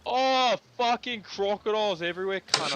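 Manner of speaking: loud, high gamer outburst; frustrated shout. Real, not acted. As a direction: yell at the screen in frustration. Crocodiles swarm in game.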